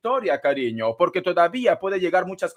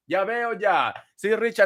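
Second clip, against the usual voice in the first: usual speaking voice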